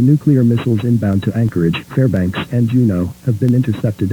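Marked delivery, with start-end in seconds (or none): none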